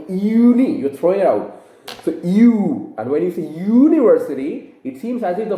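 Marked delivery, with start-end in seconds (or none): 0.1-0.8 "uni" thrown out from the throat as yoo-nee; a louder, higher "uni" said as yoo-nee; throaty uni. Shown as a demonstration. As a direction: push "uni" out hard with a Y start. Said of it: "it's coming out from your throat".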